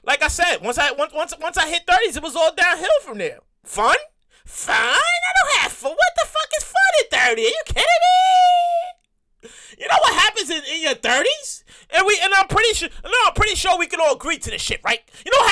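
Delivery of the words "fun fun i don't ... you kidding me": scoffing shout. Acted. Scoffing at fun at thirty.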